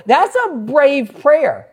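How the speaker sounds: slow, awed and admiring; slow admiring remark. Retold or imitated. Pastor telling the grandmother her prayer is brave, slow and admiring. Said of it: "I go".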